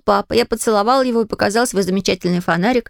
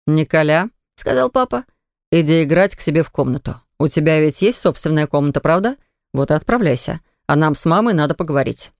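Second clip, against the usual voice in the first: stern father; 5 semitones lower than usual. A narrator's reading. Father sends boy away.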